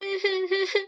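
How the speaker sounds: short high villain's cackle; mock wicked laugh; evil cackle. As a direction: cackle defiantly when caught. Caught beggar woman laughs.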